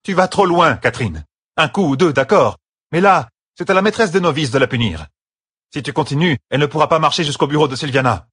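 insistent scolding in a high voice; firm objection. Acted. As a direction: argue forcefully with an overzealous colleague. Sister firmly stops colleague's beating.

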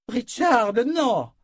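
high, loud cry of alarm; a woman's sharp cry to stop him. Acted. Nietzsche cries out to stop Richard: high, loud cry. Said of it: "cria Nietzsche".

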